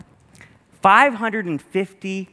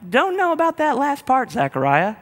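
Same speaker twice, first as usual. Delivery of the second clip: doubtful crowd; 10 semitones higher than usual. Retold or imitated. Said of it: "people were probably like".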